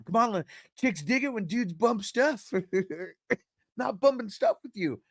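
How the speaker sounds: high, goofy put-on voice; mock Rafi voice. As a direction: play the weirdo jumping on stage. Voicing Rafi on stage.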